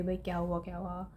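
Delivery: quietly checking on a crying friend; hushed concern. Retold or imitated.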